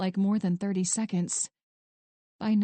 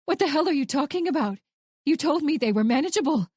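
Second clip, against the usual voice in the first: higher, louder and fast, fury breaking through; strained anger; 6 semitones higher, 4 dB louder, faster than usual. Acted. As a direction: she can't believe what she's hearing, tense and sharp. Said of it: "At her strained tone".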